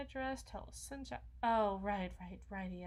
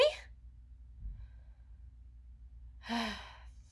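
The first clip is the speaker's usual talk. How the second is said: relieved sigh; 8 dB quieter than usual. Acted. Relief after the yes.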